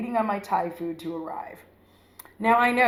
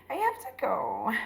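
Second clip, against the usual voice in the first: timid; 4 semitones higher, 6 dB quieter than usual. Retold or imitated. Finally telling date to leave.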